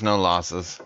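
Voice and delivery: usual speaking voice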